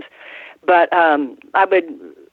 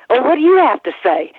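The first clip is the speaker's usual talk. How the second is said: higher-pitched imitation English accent; 7 semitones higher than usual. Friend retelling a police stop, mock English accent. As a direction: put on Davy Boy's English accent. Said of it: "Davy started in his English accent".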